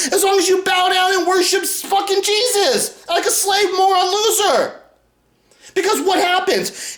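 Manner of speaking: high-pitched, worked-up yelling; real heated outcry; angry rant. Real, not acted. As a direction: rail at them, voice pushed up high. Ranting against worshipers, voice climbing.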